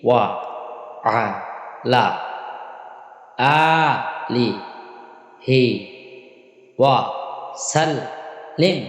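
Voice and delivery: one syllable at a time, a pause after each; broken into syllables. Shown as a demonstration. Teacher leading learners through the prayer, broken syllable by syllable. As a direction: isolate every syllable, holding the long ones. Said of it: "ভাঙা ভাঙা বলি আমরা".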